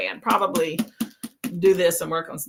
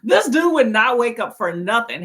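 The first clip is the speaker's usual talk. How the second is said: ordinary talk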